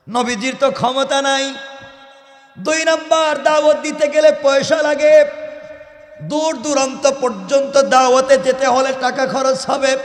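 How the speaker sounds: high, loud chanted sermon; full-force preaching. Real, not acted. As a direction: belt it out on a high sustained preaching note.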